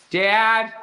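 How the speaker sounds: high mock child's call; kid calling dad. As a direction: imitate a kid hollering for a parent. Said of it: "That's the sound of being a father".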